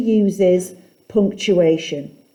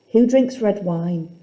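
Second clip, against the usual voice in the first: ordinary delivery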